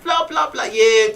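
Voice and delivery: ordinary talk, nothing marked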